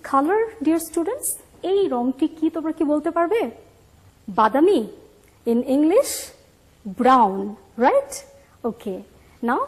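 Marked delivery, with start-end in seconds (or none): none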